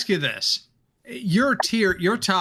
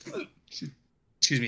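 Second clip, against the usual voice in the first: slow, hiccuping apology; hiccups; 7 dB quieter, much slower than usual. Real, not acted. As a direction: excuse yourself through a hiccup. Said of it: "here come the hiccups".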